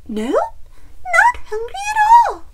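very high, loud hen squeal; flustered chicken. A narrator's reading. Chicken refuses Bear's lunch, high squealed denial. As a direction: deny it in a squeaky shout. Chicken denies hunger to Bear.

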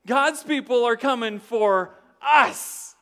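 high, loud, slow panicked cry; hammed-up shriek of alarm; mock panic. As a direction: ham up the terrified townsfolk. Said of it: "They were like".

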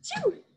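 sudden real sneeze; a sharp high sneeze. Real, not acted. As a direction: reader sneezes mid-recording.

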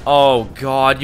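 ordinary talk

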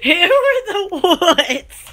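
slow, stretched-out words through laughter; real giggling. Real, not acted. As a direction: drag it out while cracking up. Cracking up repeating own phrase.

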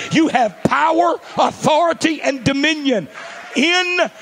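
high, loud, drawn-out proclamation; full-force preaching shout. Acted. Preacher proclaiming power to the church in a loud high shout. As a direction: shout it to the rafters, stretching world.

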